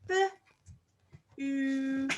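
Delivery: "B-oot" split, long "oo"; sounding out. Shown as a demonstration. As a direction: stretch the "oo" in "B-oot". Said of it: "long vowel oo".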